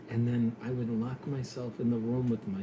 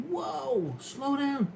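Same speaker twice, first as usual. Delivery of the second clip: startled self-warning; 13 semitones higher, much slower than usual. Retold or imitated. Telling himself off at ninety.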